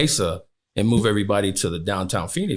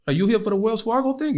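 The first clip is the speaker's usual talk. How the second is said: put-on high-pitched East Coast accent, like a Baltimore guy; 14 semitones higher than usual. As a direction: ask it like someone from Baltimore, much higher. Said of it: "He's got the East Coast accent".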